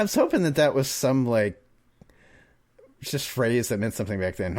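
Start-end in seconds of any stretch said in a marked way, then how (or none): none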